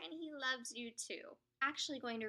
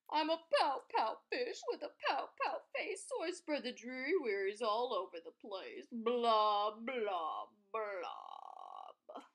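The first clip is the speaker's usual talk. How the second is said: glum pout fish; 2 semitones lower, 4 dB louder than usual. Acted. The pout fish moaning about his dreary wearies, glum. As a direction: drag it out like a sulky fish. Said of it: "glum, gloomy swimmer with an ever-present pout".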